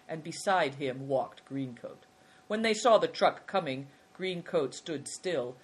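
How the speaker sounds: ordinary delivery, nothing marked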